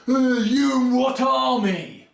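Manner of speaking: high, slow, loud taunt; hammed-up challenge, drawn out; mock taunt. Captain taunting Aragorn, mock loud challenge. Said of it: "And he says then".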